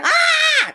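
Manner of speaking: high, loud mock holler; mock scream. Retelling the scared man in the ad, mock scream. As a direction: let out a high shrieking holler.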